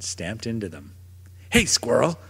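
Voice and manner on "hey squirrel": a growling call at the squirrel; gruff bark of a small dog; growled. Acted. Small dog growling at a squirrel, gruff shout. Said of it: "Sniffers growled".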